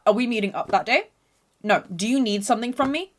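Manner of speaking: usual speaking voice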